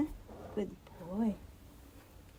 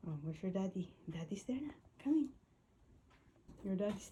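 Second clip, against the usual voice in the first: whispered aside to the dog; 11 dB quieter, faster than usual. Real, not acted. Owner telling her dog daddy is back, whispered.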